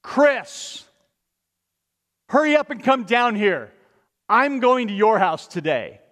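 a firm summons from below the branches; Jesus calling you by name. Pretend. Jesus calls Chris down from the tree, loud and urgent. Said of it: "Just imagine if this were you".

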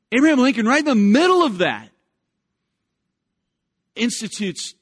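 loud, high, driving sermon build; swelling pulpit crescendo; full-force preaching. Acted. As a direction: preach it out with rising fire.

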